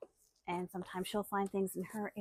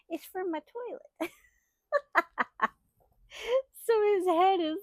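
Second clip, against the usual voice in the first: much higher and louder, playful; giddy excitement; 10 semitones higher, 5 dB louder than usual. Real, not acted. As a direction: present it with giddy delight. Showing Frankenstein figure.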